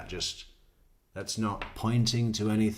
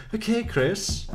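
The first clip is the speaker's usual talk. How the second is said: laughing exasperation; 13 semitones higher, 5 dB louder, slower than usual. Real, not acted. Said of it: "laughing at the suspect".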